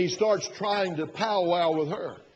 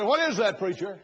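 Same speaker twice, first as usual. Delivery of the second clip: higher and louder quoted listener; mock listener question; 4 semitones higher, 4 dB louder than usual. Preacher imitates a listener's challenge in a higher, louder voice. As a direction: toss it up in a raised voice. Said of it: "You say".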